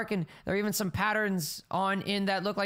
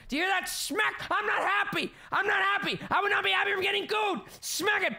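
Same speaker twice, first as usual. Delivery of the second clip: high scolding shout; 12 semitones higher than usual. Acted. Scolding tail.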